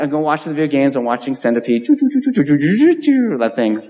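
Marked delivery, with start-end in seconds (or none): 1.9-3.8 staccato high choo choo like a game shooter; arcade blaster sound. Retold or imitated. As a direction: fire off quick choo bursts like an arcade game. Mimics Centipede arcade shooting.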